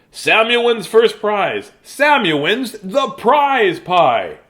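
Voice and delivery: slow, loud, high proclamation; announcing judge. Acted. Judge announces Samuel as winner, slow and grand. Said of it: "said the judge".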